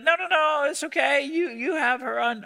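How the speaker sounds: bright fake politeness passing someone off; mock polite refusal. Team captain refusing the unwanted pick, mock polite. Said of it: "they're like".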